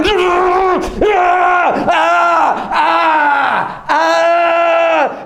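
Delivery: long high screams of elation; acted wild cheering yells; ecstatic scream. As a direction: scream as if jolted by electricity. Acts out electric connection, heaven cheering.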